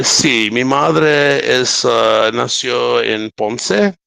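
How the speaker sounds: slow Spanish with an American accent; careful, halting Spanish with an American accent; gringo Spanish accent. Shown as a demonstration. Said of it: "Say something in Spanish".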